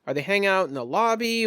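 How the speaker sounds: ordinary talk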